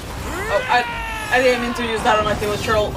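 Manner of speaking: usual speaking voice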